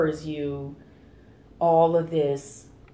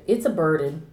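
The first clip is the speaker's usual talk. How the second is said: ordinary talk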